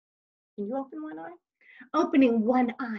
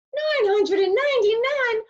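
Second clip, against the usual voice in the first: shocked disbelief; 12 semitones higher, 6 dB louder than usual. Acted. Jimmy repeats in disbelief.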